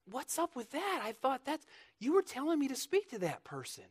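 high, soft, plaintive protest; mock complaint. Rejected believer questioning God, mock plaintive complaint.

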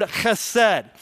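chesed, rasping ch like Scottish loch, stress first syllable; Hebrew word chesed. Shown as a demonstration. Teaching the class his Hebrew word, clear throaty chesed. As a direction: say chesed with a throaty kh at the start, KHEH-sed. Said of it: "here's your second Hebrew word of the day".

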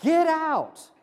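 high, emphatic order to leave; dismissive command. Acted. Townspeople tell Jesus to leave, emphatic high command. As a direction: throw the words out as an expulsion. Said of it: "they tell Jesus".

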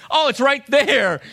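high, slow, all-together crowd answer; crowd in unison. Retold or imitated. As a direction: chime in like a whole line of guys at once. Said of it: "and in unison, unison".

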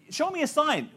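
high, laughing mockery of the request; mocking Gideon. Pastor mocking Gideon's request, high and amused. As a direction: send up the demand for a sign, pitched high. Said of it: "He says".

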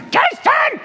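very high strained screech, re-enacted; frantic comic yelling; yelling at a kid. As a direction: scream the name after a runaway. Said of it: "yelling at him".

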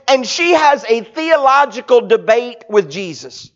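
ordinary talk

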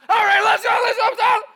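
loud, high hurry-up shout; rally the house like a coach; dad hurrying everyone. Retold or imitated. Dad rushing through the house shouting hurry.

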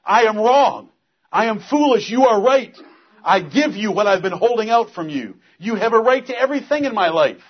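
loud, high, broken-hearted confession; repentant prayer. Pretend. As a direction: cry out your guilt to God. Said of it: "I just told you how to tear your heart".

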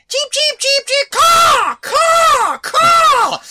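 loud high cheeping and cawing; bird imitation. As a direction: squawk it like birds. Imitating birds at dawn.

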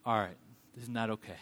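low, hushed, acted parent warning; restrained parental disapproval. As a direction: keep it down, low and controlled. Parent facing a child's refusal.

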